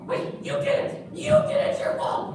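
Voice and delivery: put-on high puppet boy shouting blame; accusing puppet kid. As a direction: point the finger at the pastor. Puppet Gus blames pastor.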